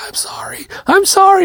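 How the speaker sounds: imitating a panicked child's very high wail; freaking out. Son who hurt his brother apologizes, freaking out. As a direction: wail the apology like a frantic kid. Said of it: "my son Elliot is freaking out".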